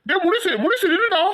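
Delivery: high, urgent call to stop; calling after someone. Acted. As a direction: call out to him as he leaves. Calling after departing servant.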